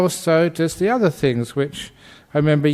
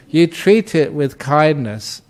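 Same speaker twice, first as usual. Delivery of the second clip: ordinary delivery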